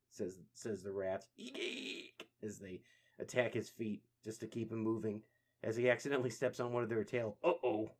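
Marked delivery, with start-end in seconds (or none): 1.4-2.3 tiny high whispered squeaks; breathy little "eek, eek" of a rat. Acted. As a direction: squeak like angry rats. Rats squeak at Raph.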